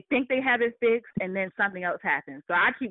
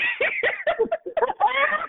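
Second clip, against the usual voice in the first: high laugh. Real, not acted. Retelling the HR lady laughing.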